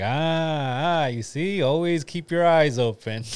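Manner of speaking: hint of a homeless man's mock wisdom; imitating homeless man. Homeless man at the bus stop warns the teller, lightly voiced.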